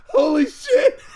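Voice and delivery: high-pitched laughing reenactment of shock; retold amazement. Host retells discovering the Kenny coincidence, laughing amazement. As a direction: act out being amazed, through laughter.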